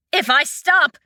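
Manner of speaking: high, panicked cry. Acted. Fen cries out to Shang, afraid. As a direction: cut off mid-protest in fear. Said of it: "Fen cried out".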